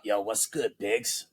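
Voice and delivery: low, soft Godfather-style Jersey drawl; Jersey boss. Retold or imitated. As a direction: lean back and purr it like a mob boss. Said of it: "He talks just like a ... Godfather boss from Jersey".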